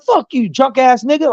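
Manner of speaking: high-pitched angry retort; retold going off. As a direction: re-enact lashing out at someone. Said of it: "I just started going off on everybody".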